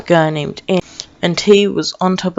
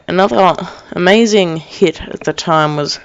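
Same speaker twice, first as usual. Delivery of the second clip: usual speaking voice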